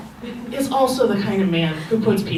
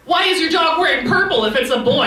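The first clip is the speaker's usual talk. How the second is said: higher-pitched mock nosy questioner; judgmental stranger; 8 semitones higher, 3 dB louder than usual. Stranger questioning a dog's purple sweater, high mocking voice. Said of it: "Those are the people who are like".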